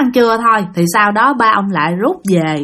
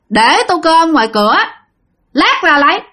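terse answer, jumping higher on the last words; curt, clipped reply; 5 semitones higher than usual. Retold or imitated. Bảy answers his elders from inside, curt and blunt. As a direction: answer in short, blunt chunks with no courtesy. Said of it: "trả lời mà theo cái kiểu nhát gừng trống không".

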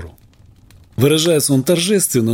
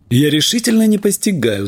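higher, agitated disbelief; excited; 6 semitones higher than usual. Acted. Doctor bursts out to his staff, excited. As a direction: exclaim it in bewildered excitement. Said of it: "заговорил я возбуждённо".

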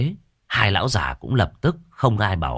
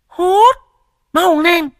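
very high, loud barked order; bossy shout; 7 dB louder, much slower than usual. Acted. Quýt Nẫu shouting at Tam to hurry, very high and loud. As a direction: bark it impatiently.

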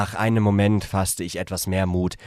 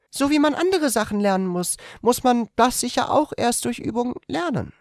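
much higher female character voice; 4 dB louder than usual. A narrator's reading. The woman tells him in bed, in a much higher female voice. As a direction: voice her as a shy young woman, gently.

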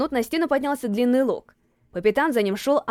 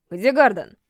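short, louder question; curt; 4 dB louder, slower than usual. A narrator's reading. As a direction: snap the question curtly. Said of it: "отрывисто спросил". (отрывисто)

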